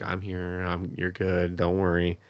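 soft, gentle comforting; soothing reassurance. Retold or imitated. Heroine comforting the crying hero, softly soothing. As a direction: hush him softly and reassure him. She comforts him as he cries.

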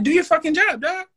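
high, loud yell at the police; angry yell. Retold or imitated. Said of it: "I'm like".

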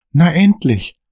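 high, relieved sigh of a line; relieved Dan. Acted. Dan relieved to be leaving at last. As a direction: finally, let it out in relief. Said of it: "Dan ist erleichtert".

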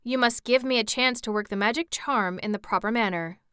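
plain reading voice

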